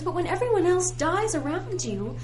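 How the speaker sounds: high, loud complaining rant; worked-up complaint; exasperated rant. Acted. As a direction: pour out the grievance. Ranting about eternal life.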